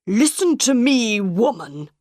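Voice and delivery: furious director scolding the woman; red-faced angry order. A narrator's reading. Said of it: "his face was red and angry".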